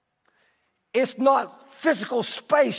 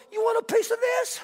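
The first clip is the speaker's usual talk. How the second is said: much higher, hammed-up challenge to a fight; mock tough guy; 11 semitones higher than usual. Pastor pretending to challenge the neighbor, mock tough. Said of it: "I started sort of like this".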